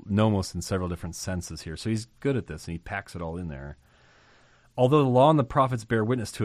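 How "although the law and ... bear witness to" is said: reading scripture aloud. Acted. Reading the Bible verse aloud.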